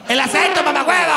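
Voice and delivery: mock shout. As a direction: bark out the insult half-joking. Friend mocks his accent.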